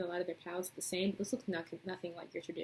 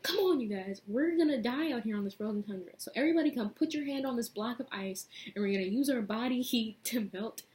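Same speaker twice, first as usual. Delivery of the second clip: higher, louder urgent call to the group; rally them like a hammed-up leader; guy urging everyone to the ice, loud and high; mock rallying call; 4 semitones higher, 7 dB louder than usual.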